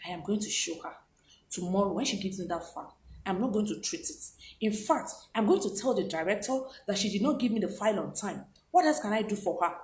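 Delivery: very soft, low plotting to oneself; acted private scheming, pitch dropped right down; bitter brooding. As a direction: think aloud in a hushed, resentful undertone. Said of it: "go inside the room and start thinking about it".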